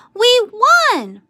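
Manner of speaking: very high, loud victory cry; excited yell. A narrator's reading. Tom yelling the win to the team, high and loud. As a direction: cheer the win at full voice. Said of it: "shouted the boys".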